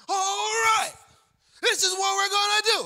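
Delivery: full-volume, high-pitched coach shout; screaming coach. Retold or imitated. Coach giving his team a pep talk, screaming. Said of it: "Why is this dude screaming?".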